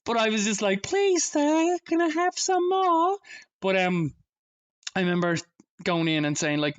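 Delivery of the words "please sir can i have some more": put-on high, slow begging child voice; pleading orphan boy. A boy begging the clan, high slow plea. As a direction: beg like a hungry orphan.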